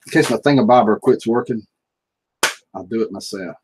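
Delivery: ordinary delivery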